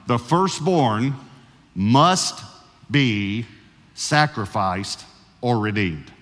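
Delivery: "must" lifted and pressed, the rest slow for note-takers; slow dictation. Shown as a demonstration. Pastor gives his first point to note-taking listeners, slowly dictated. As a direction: spell the point out slowly so it can be written down. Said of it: "leave it up long enough for you to be able to".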